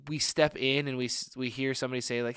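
normal talking voice

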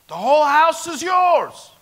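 preaching proclamation. Real, not acted. Preacher telling the church the house is theirs, ringing shout. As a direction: proclaim it with full force.

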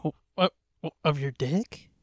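ordinary delivery

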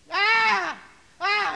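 very loud, high imitated wail; mock holler. Preacher imitating daughter testifying, hollering a wordless wail. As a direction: holler it out, hammed up. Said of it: "she hollered".

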